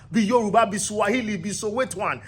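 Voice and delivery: ordinary delivery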